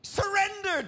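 mock outraged cry. Preacher playing a churchgoer balking at God, mock outraged cry. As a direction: cry out the word as if outraged at Jesus.

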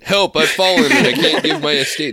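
high, loud cry for help through laughter; hammed-up helpless senior, laughing; laughing old person plea.

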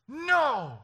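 very high, loud refusal; mock tantrum yell; imitating defiant child. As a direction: scream it like a stubborn little girl. Daughter refuses at full voice.

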